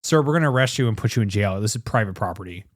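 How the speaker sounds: lightly voiced officer laying down the law; mock security officer. Playing officer answering the protester.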